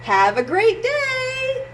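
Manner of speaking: high, slow cheerful goodbye; cheery send-off. Acted. Parent sending the child to school, high cheerful goodbye. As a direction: wave him off happily.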